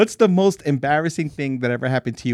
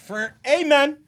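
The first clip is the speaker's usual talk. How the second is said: retold mock scream; 9 semitones higher, slower than usual. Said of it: "I screamed".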